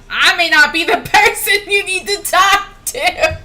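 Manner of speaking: words breaking into loud laughter; laughing. Real, not acted. Streamer reacting to huge damage, laughing loudly.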